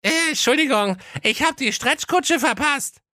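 high cartoon prince repeating at normal volume. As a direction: say it again high and patient. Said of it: "in normaler Lautstärke".

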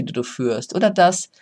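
ordinary talk, nothing marked

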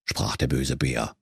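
usual speaking voice

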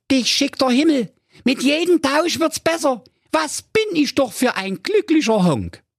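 very high gleeful cartoon cry; a happy fool cheering his luck; Honk overjoyed. A narrator's reading. Honk thanks the robber for the bike, high gleeful voice. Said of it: "rief der Honk voll Freude".

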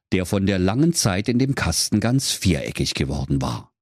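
ordinary delivery, nothing marked

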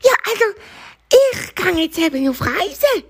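very high, jittery hare voice; nervous bunny. Acted. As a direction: squeak it out nervously like a cornered bunny. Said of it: "sagt das Häsli ganz nervös".

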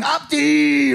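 imitated high, stretched call of a name; fans calling out. People spotting the rapper in the street, high mimicked shout.

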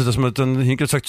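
normal talking voice

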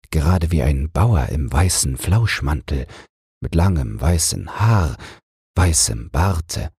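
plain reading voice

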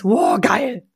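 a touch of thrilled geil; understated delight; mild excited wow. Shown as a demonstration. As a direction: marvel at it. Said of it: "dabei denkst".

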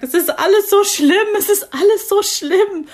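high, loud, wailing and tearful; imitating her own sobbing. New mother sobbing down the phone to her mum, high tearful wail. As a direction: wail it like a new mum who can't stop crying. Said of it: "Ich konnte nicht aufhören zu heulen".

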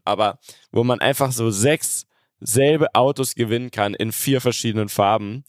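ordinary talk

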